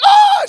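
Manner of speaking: very high, loud mock wail; upset shriek. Girl upset by pastor's joke, mock upset shriek. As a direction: shriek like she's distraught. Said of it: "she's all upset for a second".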